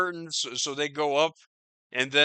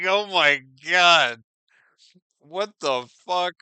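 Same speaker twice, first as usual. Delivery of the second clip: loud, slow mock shock; 8 dB louder than usual. Imitating a shocked onlooker, loud and slow. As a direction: draw out "Oh my God" loudly. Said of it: "And it's like".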